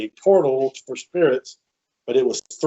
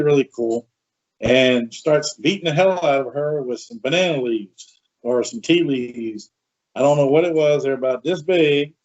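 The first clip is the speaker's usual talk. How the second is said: ordinary delivery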